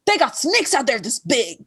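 high-pitched mock outrage; angry. Shown as a demonstration. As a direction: say it as if fed up and furious. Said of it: "if I'm angry, I go".

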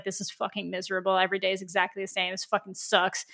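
low-key, muted mock gloom; bored misery. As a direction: imitate someone bored and miserable, softly. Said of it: "they really talk about how boring it gets".

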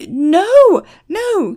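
high, loud cry of protest; panicked yelp from an arm being twisted; pained yell. A narrator's reading. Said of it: "Christopher yelled".

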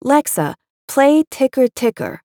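put-on high, lisping little child voice; toddler lisping. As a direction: lisp it like a toddler asking. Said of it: "a toddler lisps".

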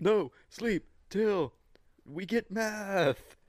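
spaced-out meth head. Pretend. Co-host joining the meth head bit, high and slow. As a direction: stretch out each word in a daze.

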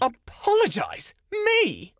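high, loud indignant squawk of disbelief; outraged disbelief. Acted. Scolded by the storyteller, she shoots back in outraged disbelief. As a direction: spit it back, scandalised that you're being asked. Outraged at being asked to apologize.

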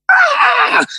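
a strained yell, cracking high on a hoarse voice; hoarse cracked yell. Retold or imitated. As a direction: belt it out until the voice cracks. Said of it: "I just went".

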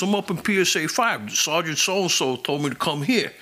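a hint of a clueless suspect; suspect's voice. Suspect explains to the cop, lightly voiced and offhand. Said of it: "He goes".

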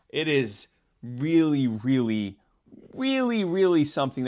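each really pushed harder; building emphasis. Real, not acted. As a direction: stack the reallys, rising. Building emphasis on Cuomo's chutzpah.

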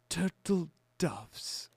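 put-on high, very slow, soft old-film character voice; shop owner. Host imitating the Home Alone shop owner, slow character voice. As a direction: stretch each word out in a quiet character voice. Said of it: "the shop owner goes".

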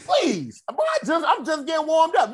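high, loud defiant comeback; loud retort. Retold or imitated. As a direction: brush him off loudly. Defiant retort to bar manager.

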